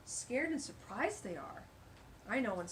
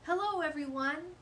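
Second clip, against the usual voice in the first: bright, loud hello; cheerful greeting; 7 semitones higher, 6 dB louder than usual. A narrator's reading. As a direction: greet the whole garden happily. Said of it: "we said to all the animals".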